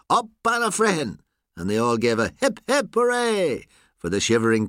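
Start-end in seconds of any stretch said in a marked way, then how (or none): 0.1-1.2 high-pitched celebratory call; shout. A narrator's reading. Someone in the cheering crowd at the catch, shouting. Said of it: "Someone shouted". 2.4-3.7 high, ringing crowd cheer; cry of joy. A narrator's reading. Spectators cheering the shivering postman, joyful cry. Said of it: "A cry of joy broke from the spectators".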